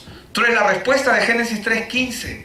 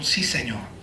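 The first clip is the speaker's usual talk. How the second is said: respuesta humilde; 10 semitones lower, 6 dB quieter, slower than usual. Retold or imitated. Respuesta humilde de los ciegos.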